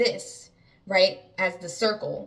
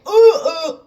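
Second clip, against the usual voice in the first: high, loud "ooh, ooh"; two startled high hoots, acted out; mock-scared wordless yelps; frightened hooting yelp; 10 semitones higher, 16 dB louder than usual. Acting out fright at face.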